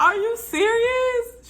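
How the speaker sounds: high, drawn-out incredulous question; stunned disbelief. Retold or imitated. Mother told of twins again, high-pitched disbelief. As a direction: stretch it out high in mock shock.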